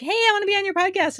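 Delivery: high, fast, eager ask; high-pitched mock guest request. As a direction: chirp it like an eager stranger pitching. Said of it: "they'll be like".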